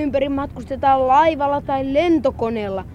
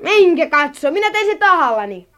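defiant; 4 semitones higher, 5 dB louder than usual. Acted. Boy defies scolding maid.

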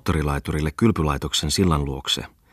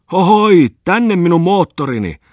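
very high, loud hail across the water; shouting and waving; 13 semitones higher, 10 dB louder, slower than usual. A narrator's reading. Bungman hails his motorboat from the pier, loud high shout. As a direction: call the boat over at full voice. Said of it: "huusi hän ja viuhtoi kättään".

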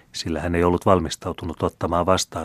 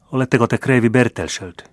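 trembling voice; 5 semitones higher, 6 dB louder than usual. A narrator's reading. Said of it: "ja ääni vapisi huomattavasti".

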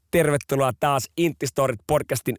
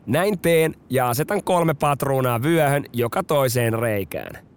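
plain reading voice

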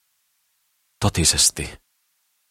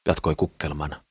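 usual speaking voice

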